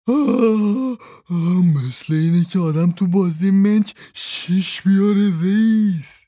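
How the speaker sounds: high excited cry, stretched at the end; joyful shout. A narrator's reading. Eddie crying out to his boss over the gold. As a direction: shout it out with glee. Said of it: "از شادی فریاد کشید".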